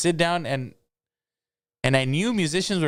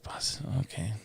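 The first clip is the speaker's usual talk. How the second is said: whispered aside; 3 semitones lower, 10 dB quieter than usual. Retold or imitated. Startled reply to the security guard.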